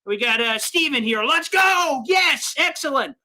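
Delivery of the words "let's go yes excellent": high, jubilant victory shout; excited cheering. Real, not acted. Host celebrates a Jets win with a commenter, excited.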